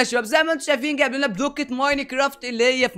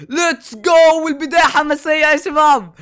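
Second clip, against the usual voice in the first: loud, high, thrilled shouting; real hype; excited shout; 5 semitones higher, 6 dB louder, slower than usual. Real, not acted. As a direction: yell it out, bursting with excitement. Thrilled TNT reveal.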